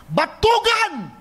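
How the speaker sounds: "Batugan" cried out high and drawn out slowly; "Batugan" as a drawn-out, high angry scold; angry rebuke. Retold or imitated. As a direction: spit out "Batugan" like a furious master. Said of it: "Ganun na ganun ang pagkakasabi".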